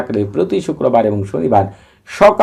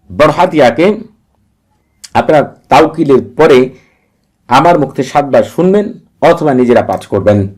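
ordinary talk

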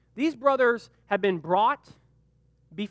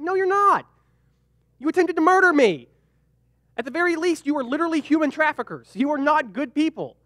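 much higher and louder, outraged; indignant rebuke; 7 semitones higher, 5 dB louder than usual. Retold or imitated. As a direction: call them out, furious and incredulous. Victim rebukes the brothers.

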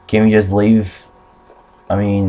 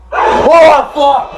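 sudden loud, high yelp; startled scream; 5 dB louder than usual. Real, not acted. Gamer caught by a jump scare, startled shout. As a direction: scream out as if jumped.

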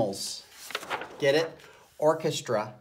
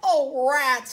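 sniveling; 12 semitones higher, 4 dB louder than usual. A narrator's reading. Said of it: "he sniveled".

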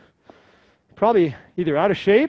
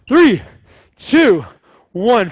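loud, high coach countdown; shouting countdown; 3 semitones higher, 7 dB louder, much slower than usual. Real, not acted. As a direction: yell the final count.

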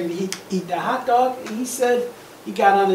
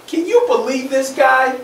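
higher, mildly exasperated complaint; annoyed pigeon; 5 semitones higher, 4 dB louder than usual. Acted. Pigeon complaining about the nosy duck, annoyed. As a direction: complain like a put-upon pigeon. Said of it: "might be getting a little annoyed".

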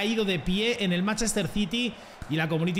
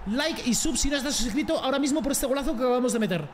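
hyped; 6 semitones higher than usual. Real, not acted. Hyped outro after goal.

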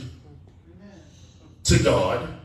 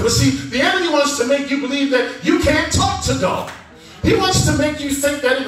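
higher, excited preaching; getting excited; 7 semitones higher, 3 dB louder than usual. Real, not acted. Preacher warning the congregation about the enemy's lie, getting excited. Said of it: "I'm starting to get excited".

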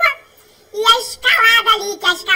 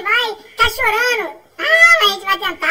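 usual speaking voice

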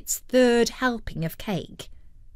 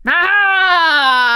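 long, high, loud "aha"; pirate captain's cry; 8 semitones higher, 9 dB louder than usual. A narrator's reading. Captain greets his returning parrot, crying out. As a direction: bellow it in triumph, drawn out. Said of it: "cried the captain".

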